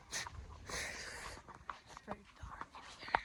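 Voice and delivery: soft whisper in the dark. Real, not acted. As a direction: whisper so the horses stay calm. Checking horses in dark stable.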